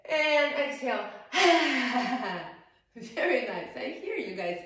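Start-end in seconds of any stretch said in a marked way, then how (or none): 0.1-1.1 loud breathy exhale; lion's breath exhale. Shown as a demonstration. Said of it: "Inhale through your nose". 1.1-2.5 loud breathy roar, tongue out; lion's breath roar. Shown as a demonstration. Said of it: "do the lion breath".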